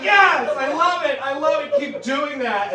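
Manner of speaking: high gleeful praise that fades off; thrilled cheering. Retold or imitated. Fan cheering the impression, high and fading. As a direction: egg him on with delight. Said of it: "You're like".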